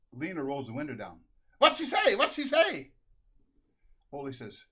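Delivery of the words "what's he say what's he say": high, loud shout from the car; shrill-pitched yell demanding to be told; loud asking. Acted. Wife calls to husband through car window, loud high shout.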